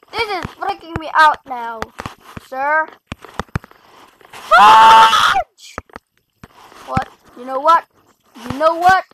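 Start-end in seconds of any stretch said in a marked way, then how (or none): 4.3-5.8 very high, loud yelp of rage; shrieking curse at the game; frustrated shout. Real, not acted. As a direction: scream the word at the game. Gamer fails, swears.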